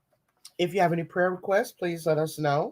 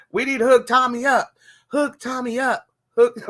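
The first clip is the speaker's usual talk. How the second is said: higher and louder, punching hook; hook Tommy up; 8 semitones higher, 5 dB louder than usual. Real, not acted. Urging viewers to set Tommy up, loud and high. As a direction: push the word hook hard.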